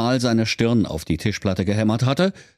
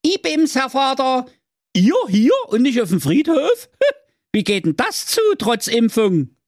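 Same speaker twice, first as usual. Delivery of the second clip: high, loud cheeky son's call; 4 dB louder than usual. Acted. Second son bursting in, calling high and loud. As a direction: burst in and tease the old man. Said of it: "herein kam der Klaus zwei und rief".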